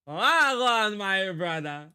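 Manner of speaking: drawn-out mock Jamaican greeting; hammed-up Jamaican accent. Repeats the Jamaican greeting, stretched.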